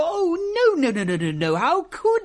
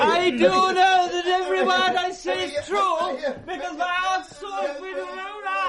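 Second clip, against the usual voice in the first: indignant insistence; 6 semitones higher than usual. Acted.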